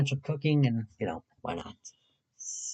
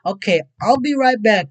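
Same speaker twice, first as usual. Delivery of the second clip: ordinary talk, nothing marked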